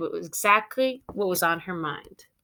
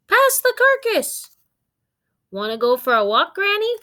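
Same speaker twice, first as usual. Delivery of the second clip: high, loud dog voice; 12 semitones higher, 6 dB louder than usual. Acted. Dog talking at family table.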